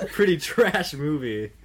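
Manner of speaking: slow, loud, laughing through it; laughing the words out. Real, not acted. Laughs at his own reasoning.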